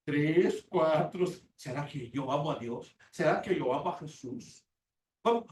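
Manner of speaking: ordinary talk, nothing marked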